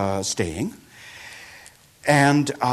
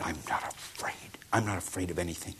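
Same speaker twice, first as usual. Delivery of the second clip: whispered vow; 5 semitones lower, 10 dB quieter than usual. Acted. Robber vows courage to Buddha.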